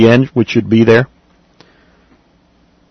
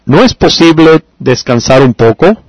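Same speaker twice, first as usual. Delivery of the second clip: "¿No es posible descansar un poco?" with a rising question melody; question tune; 3 semitones higher than usual. Shown as a demonstration. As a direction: lift the tune of the sentence to make it a question. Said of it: "change the tune a little bit of the sentence".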